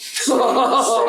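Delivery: high, slow words through real laughter; laughing. Real, not acted. Friend laughing while recalling a bar story, high and slow.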